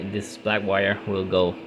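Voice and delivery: normal talking voice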